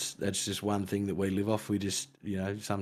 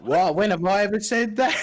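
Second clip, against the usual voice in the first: incredulous denial; 11 semitones higher, 8 dB louder than usual. Real, not acted. Denying he said it.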